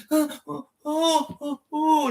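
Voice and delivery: high imitated moaning; string of high, acted "oh" moans; mock moans of enjoyment. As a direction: protest turning to pleasure, hammed up high. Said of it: "and starts enjoying it".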